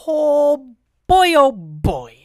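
loud, high mock alarm. Real, not acted. Reacting to the frightening Tesla disclaimer with a loud oh boy.

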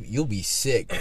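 ordinary delivery

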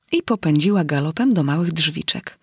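ordinary delivery, nothing marked

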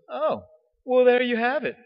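high, grand theatrical pronouncement; theatrical teacher. Retold or imitated. Teacher priest naming the seminarian, high theatrical flourish. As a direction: play up the mentor's dramatic flourish. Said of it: "he was very theatrical in the way that he spoke".

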